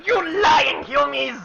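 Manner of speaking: high, slow shouted goblin accusation; angry goblin shout. Acted. Goblin accuses the party of lying, shouting. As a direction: screech it at the liars. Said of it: "He says".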